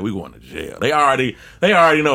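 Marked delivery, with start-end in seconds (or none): 0.0-0.8 low, soft resigned mutter; despairing friends. Retold or imitated. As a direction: murmur it like doom is certain. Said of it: "My friends is like".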